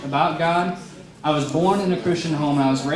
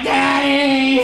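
put-on crying like a baby; 8 semitones higher, 4 dB louder than usual. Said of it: "I was crying like a baby".

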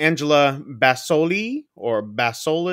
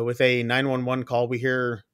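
ordinary talk, nothing marked

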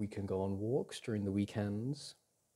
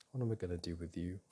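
ordinary talk, nothing marked

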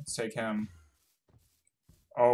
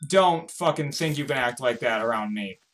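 ordinary delivery

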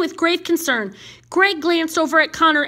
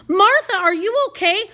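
higher-pitched, urgent questioning; alarmed concern; 7 semitones higher, 3 dB louder than usual. Acted. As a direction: burst in with worried questions. Friend rushes in alarmed.

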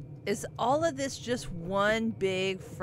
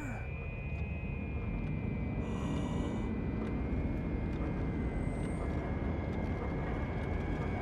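startled gasp; 8 semitones lower, 7 dB quieter than usual. Real, not acted. Scared by figure behind door.

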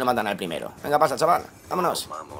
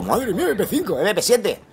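laughing disbelief, pitched up; 5 semitones higher than usual. Real, not acted. Player laughing at a teammate's weapon choice.

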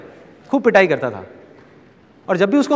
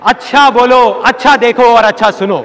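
emphatic slogan; 5 semitones higher, 7 dB louder, slower than usual. Shown as a demonstration. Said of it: "इसकी जगह पे बोलना है".

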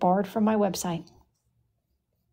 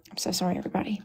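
hushed whispered apology; 5 semitones lower, 5 dB quieter, much faster than usual. Real, not acted. Streamer apologizing to viewers off-mic, whispering. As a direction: whisper it so no one nearby hears. Apologizing to chat after interruption.

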